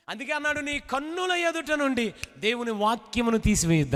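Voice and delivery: ordinary talk, nothing marked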